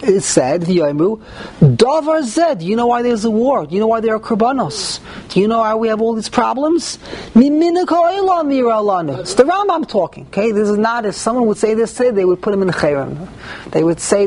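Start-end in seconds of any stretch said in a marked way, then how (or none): 1.6-9.2 higher-pitched, rhetorical questions in the naysayers' voice; voicing the naysayers. Rabbi quoting what people unfortunately say about war, voicing the naysayers. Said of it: "and will say".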